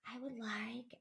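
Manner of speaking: small, hushed and shrinking inward; timid whisper. Shown as a demonstration. Coach demonstrating the wrong, timid way to ask, hushed whisper. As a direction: curl inward and barely whisper the wish. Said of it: "you start to whisper".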